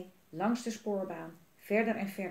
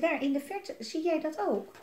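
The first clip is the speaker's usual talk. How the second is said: higher, eager story question; excited question; 8 semitones higher than usual. A narrator's reading. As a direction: ask eagerly, pitch lifted. Child in story spots something.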